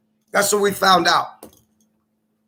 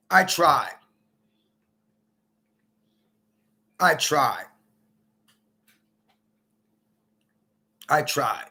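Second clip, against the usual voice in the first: each "I tried" lower and softer until almost gone; fading resignation; 7 semitones lower, 5 dB quieter, slower than usual. Real, not acted. Giving up on his wife, fading to a murmur. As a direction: let it die away, defeated.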